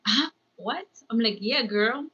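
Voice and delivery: ordinary delivery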